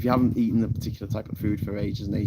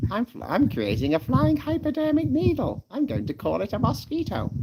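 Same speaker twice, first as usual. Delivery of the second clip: much higher, gleeful cartoon God voice; God in a joke; 14 semitones higher than usual. Streamer acts out an old joke, silly high God voice. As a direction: play God high-pitched and silly, announcing a creation. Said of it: "you know my old joke about".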